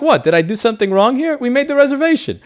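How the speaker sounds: loud, high-pitched protest; mock indignation. Diner challenges the snickering hostess, mock indignation. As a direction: protest it loudly, voice jumping up.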